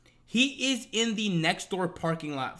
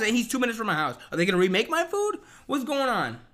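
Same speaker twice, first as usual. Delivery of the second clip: loud, higher exasperated rant; frustrated rant; 7 semitones higher, 6 dB louder than usual. Retold or imitated. Complaining that another driver is picking up his food, loud and exasperated. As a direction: vent it all in one indignant rush.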